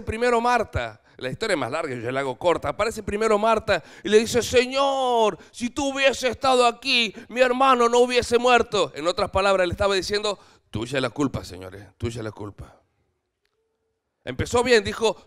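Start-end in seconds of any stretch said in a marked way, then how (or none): 4.4-8.9 higher, louder reproach; Marta's raised complaint to Jesus; reproachful plea. Retold or imitated. 10.7-12.8 lower, softer accusing mutter; quiet accusing aside to the Lord; muttered blame. Retold or imitated.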